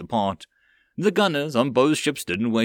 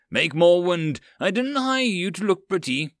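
angry order; 7 semitones higher than usual. Acted. Captain bullies the mage.

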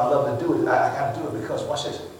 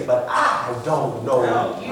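low, slow mock-puzzled denial; deadpan disavowal; 9 semitones lower, slower than usual. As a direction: draw it out low, as if facing a stranger. Star coolly disowns the fan.

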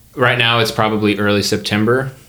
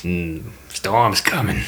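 ominous character; 4 dB quieter, slower than usual. Pretend. Game master as a character warning of a storm, slow ominous voice. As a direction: give a slow, understated warning. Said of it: "He says".

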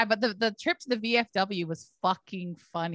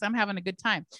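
normal talking voice

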